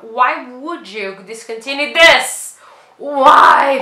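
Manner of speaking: loud frustrated why; exasperated outcry. Real, not acted. Demanding why Bioderma dropped the mask, loud outcry. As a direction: cry out at the brand in frustration.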